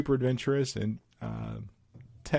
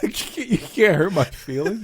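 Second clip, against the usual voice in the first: amused protest; 7 semitones higher, 5 dB louder than usual. Retold or imitated.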